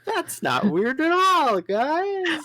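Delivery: high, loud and slow sarcasm; sarcastic. Real, not acted. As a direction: drag it out in disbelief. Sarcastic shocked reaction.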